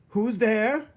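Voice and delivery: high, loud cook's shout. Acted. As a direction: bellow it out like an alarmed cook. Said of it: "shouted Cook".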